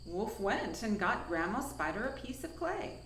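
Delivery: plain reading voice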